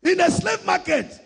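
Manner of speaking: high, forceful pulpit delivery; impassioned preaching. Real, not acted. Preacher urging church to keep their identity, impassioned. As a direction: drive each phrase out high and strong.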